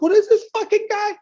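very high, squeaky mock outrage; baffled crowd. Comic imitating the appalled audience, high and squeaky. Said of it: "people are like".

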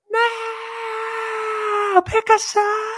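very high shrill mock cry; very high mock protest of partisans; whiny partisan mock. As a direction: whine it like a beta male partisan. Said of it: "They go".

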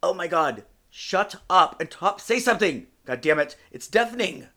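loud, emphatic comic outrage; exasperated rant. Acted. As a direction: snap at them, punching each phrase. Exasperated rant at noisy partner.

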